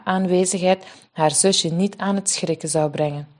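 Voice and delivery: ordinary delivery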